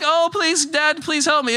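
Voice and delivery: high, lightly mocking little-child plea; pleading baby. Pastor contrasts a polite baby plea with the real demand, high and pleading. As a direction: beg sweetly, like a tiny child. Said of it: "It's not like".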